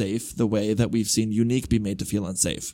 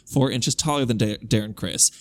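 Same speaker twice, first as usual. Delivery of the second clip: ordinary talk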